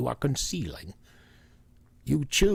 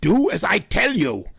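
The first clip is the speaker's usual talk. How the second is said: stern command; 6 semitones higher, 6 dB louder than usual. Acted. Professor commands.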